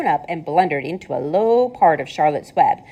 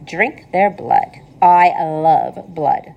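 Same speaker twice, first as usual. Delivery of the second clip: slow, pleasant spider voice; very slow, sweetly savouring; pleasant, thin voice; 4 dB louder, much slower than usual. Acted. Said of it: "in her pleasant, thin voice".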